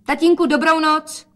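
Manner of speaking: high, loud goodnight; calling out. A narrator's reading. Daughter says goodnight to father, calling out. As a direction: call a farewell, high and carrying. Daughter calls goodbye while leaving.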